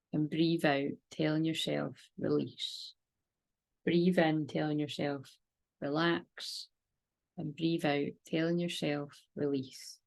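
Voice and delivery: slow, soft breathing guidance; soft spa whisper. Acted. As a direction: breathe them gently into calm. Leads breathing meditation.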